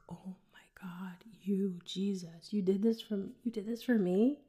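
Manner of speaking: breathless whispered prayer, barely voiced; awed whisper. Whispering to Jesus in church, overwhelmed, hushed awe. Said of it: "I just sat there and I was like".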